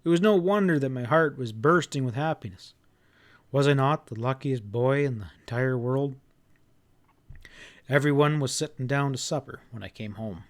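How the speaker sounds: ordinary delivery, nothing marked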